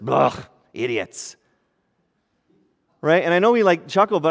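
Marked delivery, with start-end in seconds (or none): 0.1-1.3 a hint of a lower, slow, disgusted old grouch; grumpy grandfather. God imagined as a grumpy grandfather, lightly voiced disgust. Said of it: "stern, angry, disappointed, grumpy grandfather".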